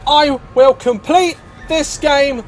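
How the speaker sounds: high-pitched, emphatic defiance; defiant shouting. Real, not acted. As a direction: shout it at the enemy in bursts. Shouting defiance at game enemies.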